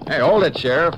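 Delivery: sudden loud call to stop. Acted. Deputy calls out to stop the sheriff, loud and sudden. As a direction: raise the voice to halt the riders. Calling out to halt riders.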